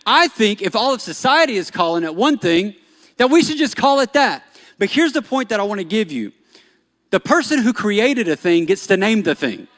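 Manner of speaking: loud, high, driving preacher voice; fiery sermon delivery; full-force preaching. Acted. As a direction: preach it out to the crowd.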